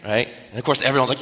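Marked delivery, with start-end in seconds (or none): 1.2-1.2 high squealed group cheer, imitated; mock cheer. Fellowship cheering a new couple's announcement, mock cheer. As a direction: squeal like a delighted crowd.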